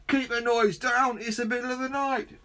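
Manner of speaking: high, fast annoyed neighbour voice; shouting neighbour. Acted. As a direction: call it across the street, fed up. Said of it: "shouted a voice from across the street".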